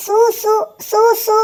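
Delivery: stuttering the first sound over and over; frightened stammer. Acted. Stammering Sootran's name to the tiger in fright.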